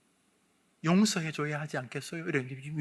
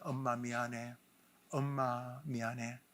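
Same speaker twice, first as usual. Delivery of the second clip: softer apology; 6 semitones lower, 8 dB quieter, slower than usual. Shown as a demonstration. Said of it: "이렇게 외치게 합니다".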